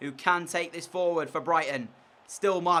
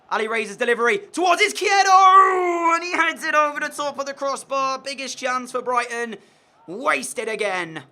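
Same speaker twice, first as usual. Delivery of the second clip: much higher, much louder, rising to a cry on the chance; excited commentary shout; 9 semitones higher, 10 dB louder than usual. Real, not acted. Player commentating his own big chance, excited shout. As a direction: call the chance like a live commentator.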